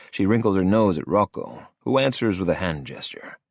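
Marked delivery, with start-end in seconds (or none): none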